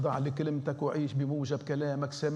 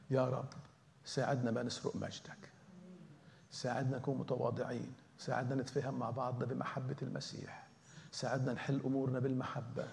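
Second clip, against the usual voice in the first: quiet, earnest prayer; hushed plea to God; soft prayer; 5 dB quieter than usual. Real, not acted. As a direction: pray it softly, asking humbly.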